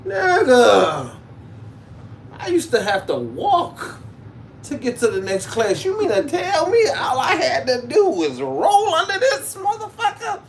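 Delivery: high, loud laugh-crying disbelief; laughing outrage. Real, not acted. Comic realizing he could roll under, laughing shout. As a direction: shriek it through laughter and tears.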